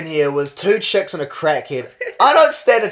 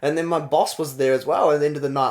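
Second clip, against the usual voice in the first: ordinary talk, nothing marked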